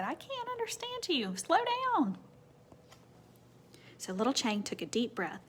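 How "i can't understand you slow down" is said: mother voice. Retold or imitated. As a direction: put on a high mom voice. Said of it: "she said".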